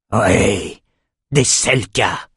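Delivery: slow, disgusted king; spat in revulsion. A narrator's reading. King recognises the sea monsters, revolted. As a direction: spit out the name with loathing. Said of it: "cracha le roi révulsé".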